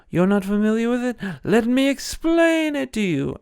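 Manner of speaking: fast, high sarcastic taunt; mock him, rising in pitch. Mocking taunt.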